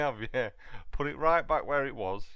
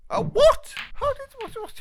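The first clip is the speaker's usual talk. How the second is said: high, loud stunned disbelief; shocked; 7 dB louder than usual. Real, not acted. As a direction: blurt it out in shock. Shocked at a shot.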